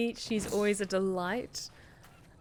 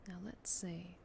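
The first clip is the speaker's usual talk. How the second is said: whispering; 13 dB quieter than usual. Real, not acted. Quiet searching aside.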